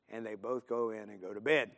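ordinary delivery

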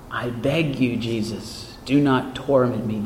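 lightly acted demon's plea, low and soft; understated low pleading. Voices the demon begging Jesus.

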